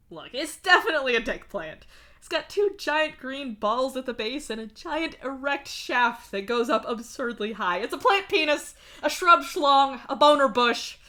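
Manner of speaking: gleeful joking. Acted. Gleefully listing rude names for a plant, giddy.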